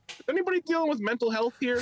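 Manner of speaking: high-pitched mock crowd question; mock comic voice. Opening his set by mocking the previous comic, high-pitched.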